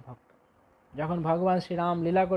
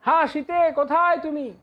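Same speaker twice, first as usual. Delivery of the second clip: crying out; 8 semitones higher than usual. Acted. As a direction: call out for the lost wife in anguish. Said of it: "চিৎকার করে".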